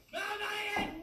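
high, loud panicked cry; panicked shout. Real, not acted. Panicked at sound effect playing.

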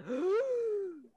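long, loud, high mock shock; scandalized gasp. Kids shocked at mom's language, mock scandalized. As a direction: draw it out in exaggerated horror.

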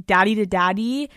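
usual speaking voice

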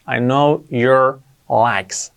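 slow, word-by-word English example; drawn-out model sentence, pauses between words; slow teaching model. Shown as a demonstration. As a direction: space out each word for learners. Teacher repeats example slowly for learners.

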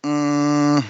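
mock-bored groan, then a feigned lost question; sarcastic. Co-host teasing the other about a long match recap, sarcastic. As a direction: play at having tuned out, groaning sarcastically. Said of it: "I was being sarcastic".

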